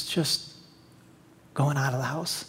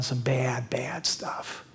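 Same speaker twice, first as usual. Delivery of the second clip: hushed whisper on the last words; 6 semitones lower, 10 dB quieter, much slower than usual. Acted. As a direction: drop to a whisper for the shameful part. Preacher drops voice for sin.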